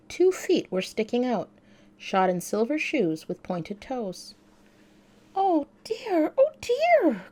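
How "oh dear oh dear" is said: much higher, rising to a loud frightened cry; cry of dismay. A narrator's reading. Dorothy seeing the witch's feet under the house, crying out in dismay. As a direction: gasp it out in growing dismay. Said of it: "cried Dorothy, clasping her hands together in dismay".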